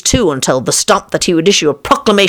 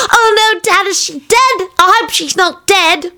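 put-on high, anxious child voice; worried little son. Son asking dad about the witch, worried high voice. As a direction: plead like an upset small boy.

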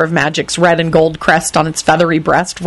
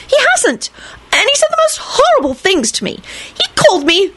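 put-on much higher, wounded girlish complaint; aggrieved Rosie voice. A narrator's reading. Rosie complaining to Lupin about Neville, high aggrieved voice. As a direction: pile on the grievance, voice up high.

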